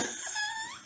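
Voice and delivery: high squeaky laugh. Real, not acted. Laughs at Southern accent remark.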